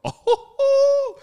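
very high, loud "ohh" hoot; mock outraged "oh". Party host reacting to being pushed, mock outrage.

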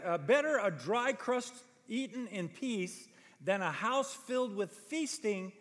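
ordinary delivery

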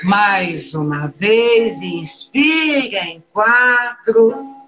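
slow, calm breath-count instructions. Acted. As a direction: guide each breath slowly, with pauses.

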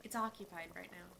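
low-key, soft and downcast; subdued admission. Acted. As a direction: say it quietly, reluctant to explain. Daughter explains painful empty room.

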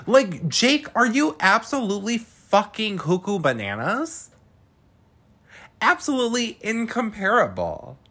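high-pitched, emphatic disbelief; incredulous scolding. Real, not acted. Host berates Jake over his absurd comparison, incredulous scolding. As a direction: tell him off, pitch way up.

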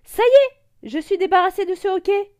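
high, loud cry of relief; overjoyed. Acted. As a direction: burst out with joy. Relieved after hiccups gone. (fou de joie)

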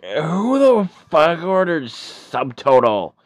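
put-on loud, high drunken yell; drunk outrage. Drunk reading the bill, yelling loud and high at the table. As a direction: bellow it, confused and furious. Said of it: "and you're like".

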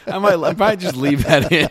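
normal talking voice

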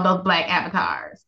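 usual speaking voice

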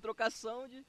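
speaking away from the mic; far from mic. Real, not acted. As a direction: voice distant from the microphone. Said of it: "eu tô longe do microfone aqui".

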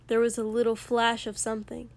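ordinary delivery

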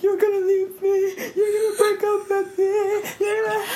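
very high, mock-sobbing plea; retold hysterical crying. Retelling a panic to the boyfriend, hysterical crying. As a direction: whine it high, imitating panicked tears. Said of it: "And I'm crying so hard".

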